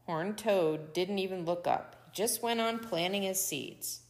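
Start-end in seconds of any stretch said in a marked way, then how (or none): none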